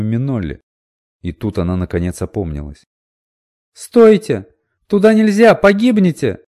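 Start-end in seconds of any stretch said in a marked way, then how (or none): 3.7-6.4 high, loud panicked warning shout; desperate shout. A narrator's reading. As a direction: yell it out to stop them. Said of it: "Миноли тоже закричала".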